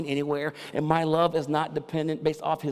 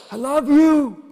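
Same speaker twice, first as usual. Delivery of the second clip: loud, high, open-throated yell; proud shout; 12 dB louder than usual. Retold or imitated. Father shouting his love to his son after a good play. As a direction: yell it so the whole stadium hears.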